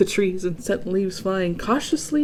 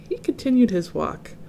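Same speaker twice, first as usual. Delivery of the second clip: plain reading voice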